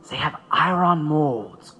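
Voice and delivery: usual speaking voice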